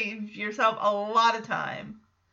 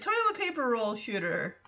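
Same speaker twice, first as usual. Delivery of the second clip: ordinary talk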